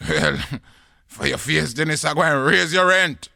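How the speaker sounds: angry landlord. Acted. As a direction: threaten the tenant at full voice. Landlord threatens tenant in quarrel.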